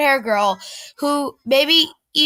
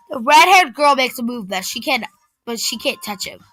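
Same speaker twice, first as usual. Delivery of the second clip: plain reading voice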